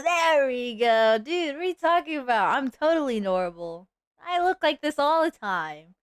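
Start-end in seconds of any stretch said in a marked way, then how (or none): 0.0-1.2 drawn-out satisfied there we go; slow drawn out. Real, not acted. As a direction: stretch it slowly. Found hotkey.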